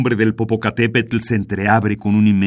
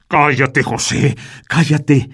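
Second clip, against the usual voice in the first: sharp louder cry to silence him; shouted hush; 6 semitones higher, 4 dB louder, slower than usual. Acted. Martínez yelling at José to shut up, loud. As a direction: shout him down, scared and angry.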